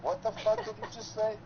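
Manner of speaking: high, stunned disbelief; incredulous. Retold or imitated. Retelling his reaction to the vein name, a high incredulous squawk. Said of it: "I'm like".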